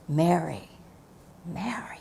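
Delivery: soft, low, tender calling of Mary, fading to near silence; tender, emotional call. Acted. Jesus calling Mary at the tomb, tender and hushed. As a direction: say her name like a loving voice in the dark. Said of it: "put the emotion that I imagine".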